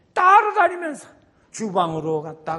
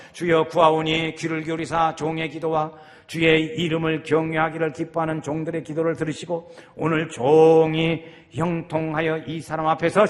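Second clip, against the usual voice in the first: scripture reading; 7 dB louder than usual. Shown as a demonstration. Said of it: "제가 쭉 읽겠어요".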